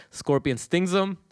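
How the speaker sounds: ordinary delivery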